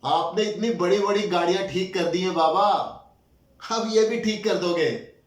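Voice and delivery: put-on loud, high child's plea to father; earnest appeal. Child urging weeping baba to fix it, earnest loud appeal.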